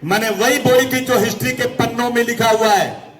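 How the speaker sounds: high-pitched, sustained political declamation; rally oratory. Real, not acted. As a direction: proclaim it to the whole crowd. (ऊंची आवाज़ में जोशीला भाषण)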